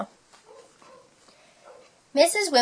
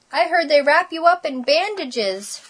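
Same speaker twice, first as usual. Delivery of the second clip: higher-pitched scary rumour; wide-eyed alarm; 6 semitones higher than usual. A narrator's reading. Spike warns Splat about doctors, alarmed.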